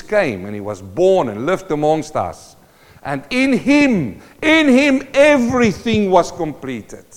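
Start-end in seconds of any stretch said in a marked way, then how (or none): 3.0-7.1 high, loud, rising proclamation; preaching at full force. Real, not acted. Preacher proclaiming Christ to his congregation, loud and high. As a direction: cry it out to the church.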